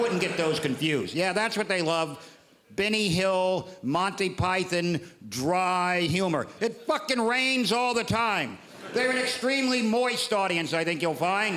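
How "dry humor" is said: usual speaking voice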